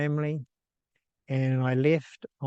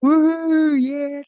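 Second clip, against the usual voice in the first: much higher, much louder joyful whoop; excited whoop; 9 dB louder than usual. Retold or imitated. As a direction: let out a delighted cheer. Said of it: "I was so excited and so grateful".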